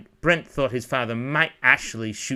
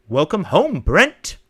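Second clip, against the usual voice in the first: louder, lifting up on the name; wry self-welcome; 5 semitones higher, 7 dB louder, slower than usual. Acted. Brent welcoming himself under an ominous sky, wry. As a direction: greet yourself wryly, ending on a rise.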